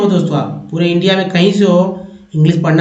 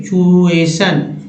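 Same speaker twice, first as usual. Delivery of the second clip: slow; much slower than usual. Shown as a demonstration. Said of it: "टी आई को चू बोलते हैं".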